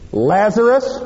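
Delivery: Jesus calling Lazarus out of the tomb. Acted. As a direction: call him from the tomb.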